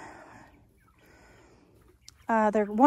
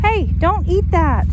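scolding; 8 semitones higher, 10 dB louder than usual. Real, not acted. Scolding dog eating something.

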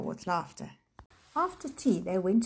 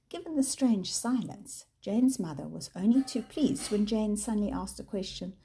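ordinary delivery

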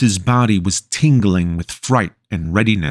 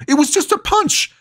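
much higher, desperate protest; panicked scream. Acted. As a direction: scream it in disbelief. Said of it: "Lucas screamed".

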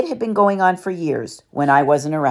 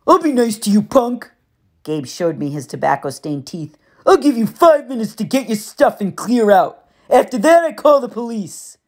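sneering Gabe; 7 semitones higher than usual. A narrator's reading. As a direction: give the kid five minutes. Gabe voiced in narration.

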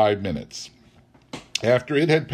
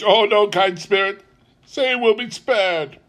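high-pitched beseeching; desperate pleading; 10 semitones higher, 5 dB louder than usual. A narrator's reading. Scrooge begs the kind spirit to spare the child, pleading. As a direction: beg the spirit for mercy.

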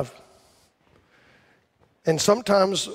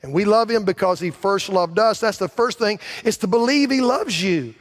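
preaching at full force; 5 semitones higher than usual. Acted. Pastor urging the congregation to believe God loves them, in full preaching force. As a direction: drive it home like a revival preacher.

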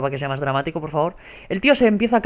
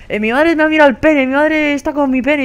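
mocking taunt; 8 semitones higher, 5 dB louder than usual. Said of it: "conoce a todo el mundo y dice".

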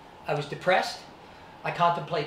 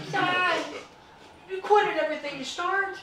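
high mock quote; 12 semitones higher than usual. Retold voice of dad.

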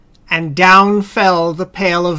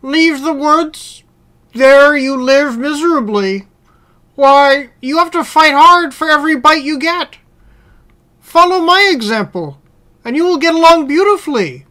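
higher-pitched persuasive dog voice; well-fed house dog; 6 semitones higher than usual. Acted. House dog advising the hungry wolf, higher persuasive voice. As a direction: coax in a raised character voice.

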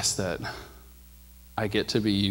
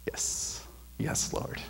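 breathy whisper, barely voiced; whispered assent; 9 dB quieter, much slower than usual. Answering God's call in a hushed whisper. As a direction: whisper it like a private prayer.

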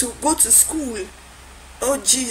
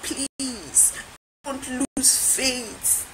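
hushed, drawn-out pleading; slow, quiet entreaty; soft plea; 8 dB quieter, much slower than usual. Acted. Pleading at end of exhortation.

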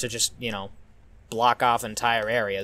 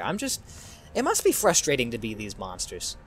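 ordinary talk

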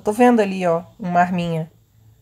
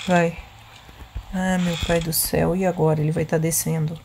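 usual speaking voice

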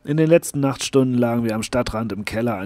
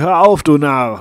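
louder, slow angry yell; shouted curse; 5 semitones higher, 11 dB louder than usual. Acted. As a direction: yell at the fool to stop firing. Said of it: "ich fluchte und schrie".

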